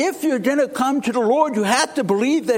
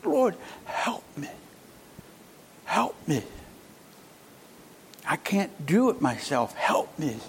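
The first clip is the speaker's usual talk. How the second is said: softer, aching plea; pained pleading; 5 semitones lower, 7 dB quieter than usual. Acted. Kneeling woman pleading with Jesus, soft and pained. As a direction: keep begging quietly, hurting. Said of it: "You can hear the pain in her voice".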